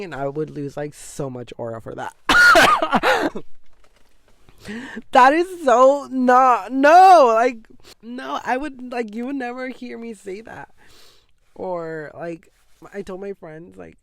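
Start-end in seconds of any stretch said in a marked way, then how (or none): 2.2-5.1 loud high burst of laughter. Real, not acted. Laughs at own remark. 5.1-10.1 loud protest. Real, not acted. Laughing protest at own remark.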